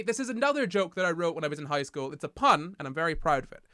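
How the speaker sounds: ordinary talk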